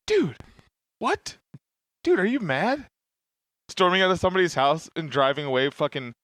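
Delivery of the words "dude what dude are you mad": feigned innocence. Retold or imitated. Gaslighting friend feigning innocence.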